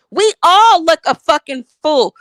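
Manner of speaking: normal talking voice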